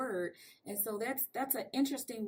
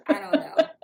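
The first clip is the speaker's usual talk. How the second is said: loud, high, breaking into laughter; laughing; 7 semitones higher, 12 dB louder than usual. Real, not acted. Joking to guest about own mixed accent, laughing. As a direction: let a big laugh burst through the words.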